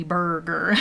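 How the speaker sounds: "burger" with heavy American R's; self-mocking American "burger", R's overdone; exaggerated American R's. Shown as a demonstration. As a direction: lean hard on both R's in "burger". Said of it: "We use too many R's".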